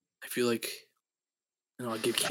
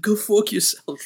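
high, loud mock snarl; loud high mock yell; impression attempt; 10 semitones higher, 8 dB louder, slower than usual. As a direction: spit it out in a put-on voice. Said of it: "I can't even do it".

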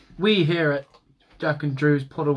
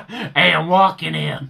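slow, louder New York cabbie yell; New York accent; 2 semitones higher, 7 dB louder than usual. Pretend. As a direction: bark it like an annoyed New Yorker on the street. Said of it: "sound like a New Yorker".

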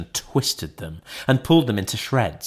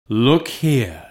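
a hint of a grave ghost, restrained; lightly voiced solemn spirit. A narrator's reading. Ghost shows Scrooge the children.

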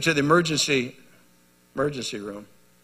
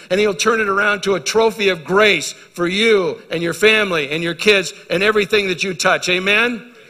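higher, louder revival preaching; preaching at full force; 6 semitones higher, 6 dB louder than usual. Acted. Preacher to congregation, full-force rousing preaching. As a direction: rouse the congregation to praise.

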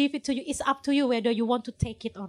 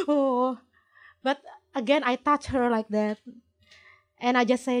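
usual speaking voice

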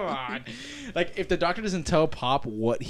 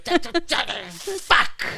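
imitating grandpa; 13 semitones higher than usual. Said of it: "he goes".

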